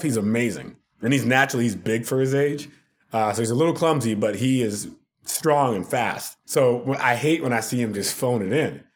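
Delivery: normal talking voice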